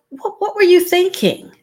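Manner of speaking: high, incredulous question; surprised. Shown as a demonstration. Asked about a surprising situation, high-pitched surprise. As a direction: stammer the first word, pitched high in surprise. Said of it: "situations that make us surprised".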